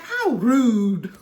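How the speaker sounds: prim, clipped mock offense; mock offended. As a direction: act mildly scandalized. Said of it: "I'd be like".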